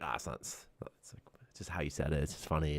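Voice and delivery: unvoiced, hushed whisper. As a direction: whisper it so the kids won't hear. Hiding laughter from the kids.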